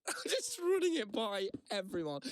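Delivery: high, slow, through laughter; laughing confession. Real, not acted. Owning up to asking everyone at the bar, laughing. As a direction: squeal it out while cracking up. Laughing at his own over-checking.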